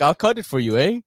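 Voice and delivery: light mock Canadian accent; a hint of a Canadian, friendly; lightly tossed-off Canadian accent. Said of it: "Is that your Canadian accent?".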